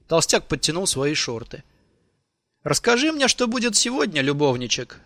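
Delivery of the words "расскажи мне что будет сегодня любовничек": high-pitched teasing lilt; envious teasing. A narrator's reading. Sherman teasing his friend while serving, slightly envious. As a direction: tease him with a note of envy. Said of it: "с ноткой зависти поддразнил его Шерман".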